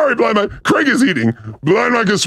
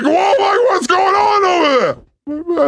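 mock amazed; 5 semitones higher, slower than usual. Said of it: "It's like".

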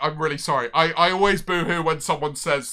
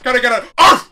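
hype bark cue; 5 semitones higher, 10 dB louder than usual. Real, not acted.

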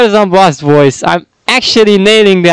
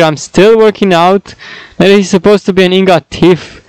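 ordinary delivery

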